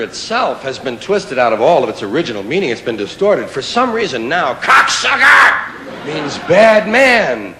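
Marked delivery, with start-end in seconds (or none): 4.5-7.5 mock outrage. Indignant punchline on word meaning.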